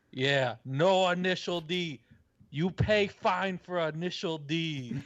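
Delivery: a hint of a Spanish-Japanese accent. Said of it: "interesting Spanish-Japanese accent you had".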